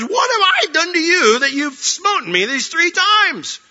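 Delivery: high, drawn-out wail of protest; slow, high-pitched aggrieved cry; hurt, complaining donkey. Acted. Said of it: "communicate verbally what he's feeling".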